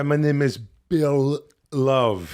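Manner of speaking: very slow, put-on strongman voice; Arnold Schwarzenegger impersonation. Podcaster resuming his bit after co-host's teasing, slow Schwarzenegger impersonation. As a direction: drag out each word like an Austrian action star. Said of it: "An Arnold Schwarzenegger impersonation".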